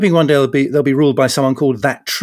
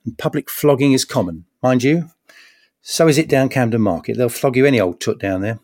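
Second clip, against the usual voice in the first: ordinary talk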